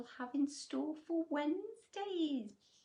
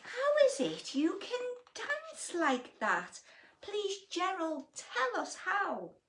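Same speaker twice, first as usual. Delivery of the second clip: high, louder begging; eager pleading; 8 semitones higher, 5 dB louder than usual. Acted. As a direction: plead excitedly. Animals beg Gerald.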